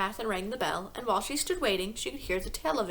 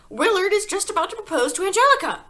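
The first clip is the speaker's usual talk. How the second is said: much higher, loud put-on wife voice; whiny Mrs Wormwood; 10 semitones higher, 10 dB louder than usual. A narrator's reading. Wife complaining to husband about her show, high and loud. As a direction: fret about missing the soap.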